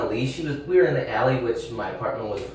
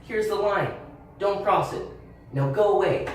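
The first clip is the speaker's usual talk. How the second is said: firm, higher pitched line drawing; reliving a telling-off of a stranger, firm and a touch higher; 8 semitones higher than usual. Retold or imitated. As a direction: set the boundary firmly. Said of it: "tell another adult".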